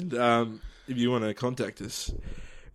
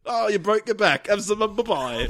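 high mock Cockney butler; fussing London butler, half laughing; comic Cockney sympathy, pitched up; Michael Caine; 8 semitones higher, 4 dB louder than usual. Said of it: "I'm Michael Caine".

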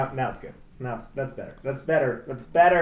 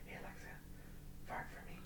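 very soft, voiceless whisper; hushed imitation whisper; 26 dB quieter, much faster than usual. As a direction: whisper secretly to a voice assistant. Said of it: "Alexa's whispering back, and he's like".

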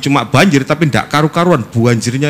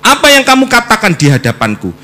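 proclaimed verse; 11 semitones higher, 7 dB louder than usual. Acted. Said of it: "ini selalu saya pegang".

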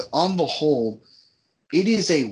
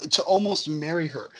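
usual speaking voice

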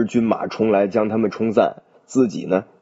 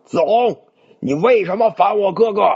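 high, loud, angry call from the bridge; loud accusing shout; 10 semitones higher than usual. A narrator's reading. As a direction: bellow the accusation. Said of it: "大声叫道".

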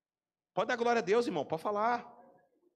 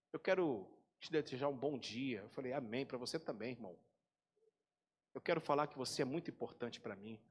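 much softer, hushed voice of the caller; soft prophetic caller; 6 semitones lower, 8 dB quieter, faster than usual. Retold or imitated. Pastor imitating a caller's hushed blessing, much softer. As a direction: whisper the blessing gently like a prophet.

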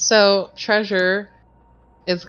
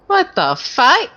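high, loud stunned outburst; shocked; 9 semitones higher, 5 dB louder than usual. Acted. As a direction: blurt it in total disbelief. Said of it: "That is literally what Treasure says too".

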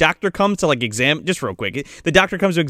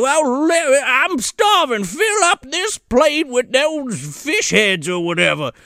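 put-on slow, high old country coot; drawn-out put-on old-timer voice; grumbling grandpa; 8 semitones higher, slower than usual. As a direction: demand supper as a hammed-up old grandpa. Retelling hungry grandpa at the table.

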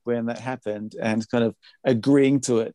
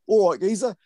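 higher, louder London geezer greeting; London geezer accent; 8 semitones higher, 5 dB louder than usual. Retold or imitated. Producer imitating the band's London geezer accent. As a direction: call out like a cheeky London geezer. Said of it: "gonna put on the same accent now".

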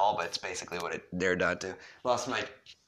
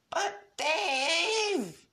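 long, high, stretched whine; drawn-out whine; 5 dB louder than usual. Acted. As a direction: drag the name out annoyingly. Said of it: "very drawn-out and annoying manner".

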